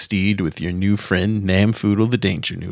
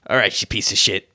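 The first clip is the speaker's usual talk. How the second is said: threatening, rising to a high shout; gruff Jim Bob koala; 9 semitones higher than usual. Acted. GM voicing Jim Bob threatening the party, shrill threat. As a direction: snarl it like a cornered bandit. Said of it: "and Jim Bob says".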